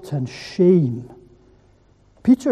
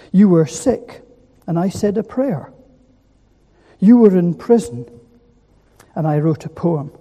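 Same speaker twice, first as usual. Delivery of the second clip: poem reading; 4 dB louder than usual. Acted. Reads a Steve Turner poem.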